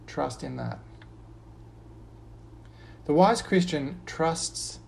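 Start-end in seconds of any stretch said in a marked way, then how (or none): 0.1-0.8 soft refrain. Acted. Pastor closing each verse with a soft refrain. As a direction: drop to a soft, settled close.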